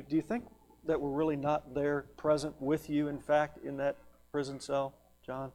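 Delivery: soft, quick run of probing questions; hushed, earnest pleading; gentle rebuke. Acted. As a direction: reprove him quietly and tenderly. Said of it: "This gentle rebuke".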